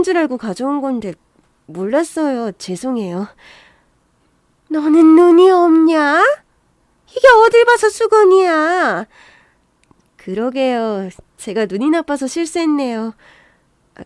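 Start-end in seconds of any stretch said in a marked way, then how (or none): 4.7-9.1 loud, high, drawn-out yelling; shrieked mother-in-law rebuke; furious scolding. Acted. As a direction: bawl out a daughter-in-law, stretching each word. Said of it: "성질 부리는".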